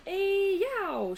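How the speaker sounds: high, loud effort cry; mother heaving on a tough vegetable; straining grunt. A narrator's reading. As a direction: strain as the knife goes in. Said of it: "she said when she cut them".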